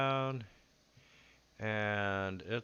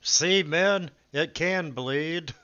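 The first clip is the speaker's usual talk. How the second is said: taunting captain; 7 semitones higher, 11 dB louder than usual. Acted. Bandit captain taunting his men.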